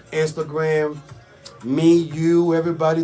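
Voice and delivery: ordinary talk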